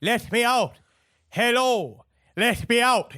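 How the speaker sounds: comic Irish plea to be let out; Irish accent. Acted. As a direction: call from the coffin in an Irish accent. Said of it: "perhaps you can do this".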